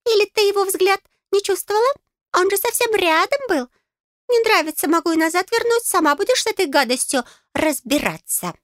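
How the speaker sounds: high, piping little voice; tiny fairy, pointed and miffed; huffy, threatening fairy. A narrator's reading. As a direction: threaten her in a squeak.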